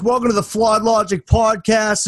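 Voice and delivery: loud, high proclamation; town crier. Pretend. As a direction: announce it like a herald. Podcast opening, mock proclamation.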